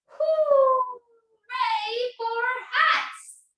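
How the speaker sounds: high, very slow drawn-out cheer; happy owl cheer. Acted. Owl cheering for hats, high and drawn out. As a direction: stretch the hooray like a delighted owl. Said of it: "And owl says".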